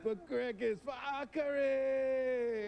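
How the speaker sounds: high shouted war cry; rally the troops, yelling; battle cry. Acted. Warrior baroness cries for her fallen, high and shouted. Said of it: "Okay, [name]".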